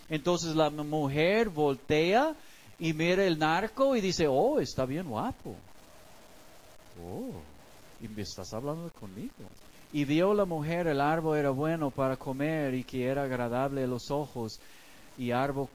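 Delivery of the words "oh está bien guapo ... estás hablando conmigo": softer, trailing off flustered; charmed and a bit coy; flattered woman. Acted. The woman is won over by the charming dealer, soft and coy.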